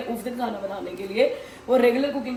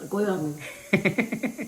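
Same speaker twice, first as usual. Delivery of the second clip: laughing it off; 2 semitones lower, 5 dB quieter, much slower than usual. Real, not acted. Laughing off the warning.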